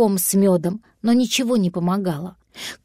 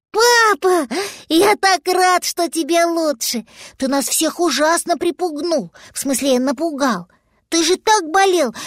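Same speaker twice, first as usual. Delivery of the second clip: excited little dragon; 5 semitones higher than usual. Acted.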